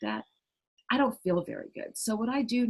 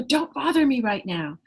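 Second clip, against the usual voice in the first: louder and higher, an irritated brush-off; loud snap; 6 semitones higher, 6 dB louder than usual. Retold or imitated. Busy parent snapping at a nagging child, loud. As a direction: snap at the kid to back off. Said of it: "that child's hearing your loud voice".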